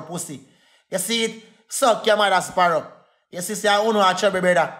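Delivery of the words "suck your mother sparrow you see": ordinary talk, nothing marked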